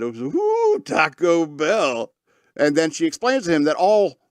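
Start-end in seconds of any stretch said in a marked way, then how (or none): 0.2-2.1 mock gushing delight, stretched slow; excited. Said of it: "she gets all excited".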